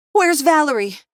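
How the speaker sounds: loud, high frantic cry; mother screaming for her daughter; panicked scream. A narrator's reading. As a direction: shriek for her missing child. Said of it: "she screamed".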